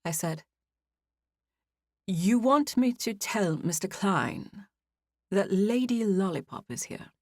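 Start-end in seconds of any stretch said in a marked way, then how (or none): 2.1-7.1 slow, careful British clarifying question; a hint of a British clerk double-checking, deliberately; British accent. Clerk confirms the guest's odd name, in a slow British accent. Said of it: "he said in a British accent".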